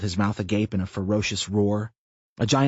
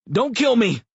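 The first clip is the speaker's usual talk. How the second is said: high, loud frightened begging; panicked plea; 5 dB louder than usual. A narrator's reading. A bald man at gunpoint begging Tina, loud and high. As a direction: beg for your life.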